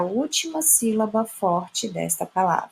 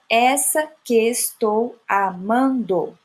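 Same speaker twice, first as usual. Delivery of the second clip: slow, separated syllables; deliberate chopped scansion; much slower than usual. Shown as a demonstration. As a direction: read the verse out in measured pieces. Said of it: "seria um exemplo de um verso".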